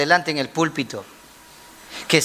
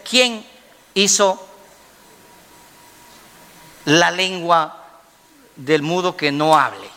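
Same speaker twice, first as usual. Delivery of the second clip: measured, with long pauses between phrases; deliberate divine question; slower than usual. Acted. God questions Moses, deliberate and halting for weight. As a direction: hand out the question piece by piece.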